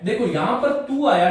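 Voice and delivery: ordinary delivery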